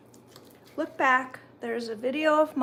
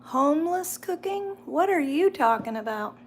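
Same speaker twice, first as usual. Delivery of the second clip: incredulous; 4 semitones higher, 5 dB louder than usual. Real, not acted. Reacting to a chat comment.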